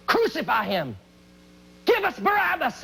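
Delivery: high, urgent mob chant of crucify; rising crowd cry. Retold or imitated. The crowd demanding Jesus be crucified, high and urgent. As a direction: voice the mob whipping itself up, pitched high. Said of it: "turned to cries of".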